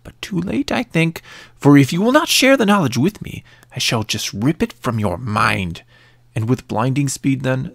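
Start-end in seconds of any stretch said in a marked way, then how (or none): 0.0-5.8 cackling wicked witch. Acted. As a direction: sneer it like a cackling hag. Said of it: "long cackling laugh before saying".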